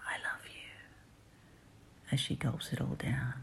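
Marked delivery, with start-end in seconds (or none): 0.1-0.7 barely audible whisper; soft whisper. Acted. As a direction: breathe it softly into the ear. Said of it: "and I'll whisper softly into your ear".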